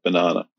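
"banana" with a long ah in the middle; Australian pronunciation of banana. Shown as a demonstration. Australian showing an American the difference, "banana" with a long ah. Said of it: "pronounce things differently to you".

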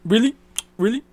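loud, high, sharply rising question; mock incredulous dad. Dad walking in on the snack bag, mock disbelief. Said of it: "he's just like".

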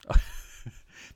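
short unvoiced laugh; breathy laugh. Real, not acted. Laughs at own joke.

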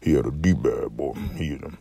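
attempted deep uncle voice, slipping into it; soft, rumbling deep-voice impression; Uncle Sam. Shown as a demonstration. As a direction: talk very low and quiet from the throat. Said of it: "I'm trying to talk like".

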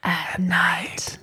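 soft unvoiced spooky whisper. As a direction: breathe it like a secret. Spooky tag after Bargain Den.

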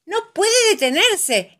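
aguda, fuerte y alarmada; exclamación preocupada. A narrator's reading. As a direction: exclama con apuro, en tono muy alto. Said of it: "exclama papá Pig preocupado". (alarma de papá de cuento)

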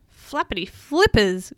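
lightly acted shock; mild gasp. A narrator's reading. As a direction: act a startled gasp for a storybook. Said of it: "gasped Peso".

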